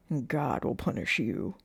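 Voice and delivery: low, measured warning; sheriff's threat. A narrator's reading. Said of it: "she heard the sheriff say".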